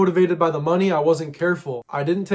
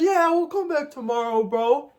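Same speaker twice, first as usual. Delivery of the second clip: a much higher, goofy naive voice; mocking dumb self; 9 semitones higher than usual. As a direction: parody your own gullible reply. Mocking their own naive answer.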